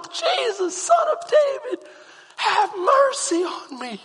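high, slow crying out for mercy; pleading cry. Retold or imitated. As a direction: call to Jesus like a blind beggar. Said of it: "And I can remember going".

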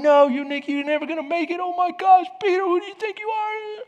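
high-pitched mock panic; freaking out. Disciples sitting in the boat doubting Peter, high mock freak-out. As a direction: squeal it like an alarmed onlooker. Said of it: "they're sitting there freaking out, going".

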